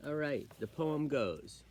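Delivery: ordinary delivery, nothing marked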